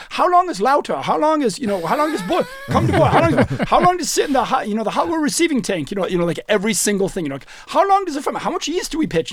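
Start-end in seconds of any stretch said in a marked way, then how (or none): 0.1-5.9 high, insistent mock interrogation; quizzing brewmaster. Brewmaster grilling Phil on every step, high insistent imitation. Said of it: "makes him walk through every single little". 7.7-9.3 high-pitched imitated quizzing; quizzing brewmaster. Brewmaster grilling Phil on every question, imitated, high-pitched. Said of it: "like, every single question".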